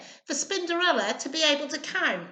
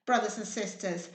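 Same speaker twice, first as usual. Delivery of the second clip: ordinary delivery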